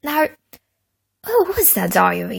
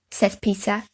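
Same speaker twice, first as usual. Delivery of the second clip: ordinary delivery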